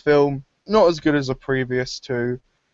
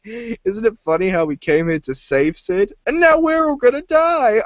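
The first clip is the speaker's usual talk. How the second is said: panicked sarcasm; 9 semitones higher than usual. Retold or imitated. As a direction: go from chatty to shrieking. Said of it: "He's like".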